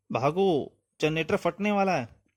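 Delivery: high-pitched cry of alarm; urgent warning. Acted. Warning of exploding generator.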